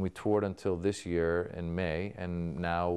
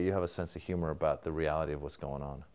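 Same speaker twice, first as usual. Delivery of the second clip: ordinary talk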